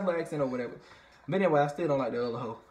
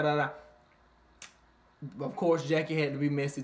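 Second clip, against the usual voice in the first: usual speaking voice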